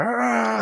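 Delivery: high, drawn-out mock yell; imitated wrestler roar. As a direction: imitate a shirt-ripping wrestler's high cry. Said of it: "he rips his shirt and he's like".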